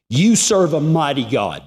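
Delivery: emphatic repeat of a praise; retold exclamation. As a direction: declare it like a verdict, starting high. Repeats the man's exact words.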